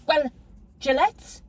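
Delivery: normal talking voice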